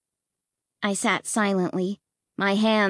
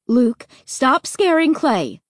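slow, louder firm warning; scolding mom; 5 semitones higher, 4 dB louder than usual. Acted. Mom turning to scold Luke, firm warning. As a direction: warn him off firmly. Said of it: "Mom scolding".

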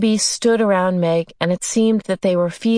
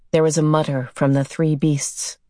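ordinary delivery, nothing marked

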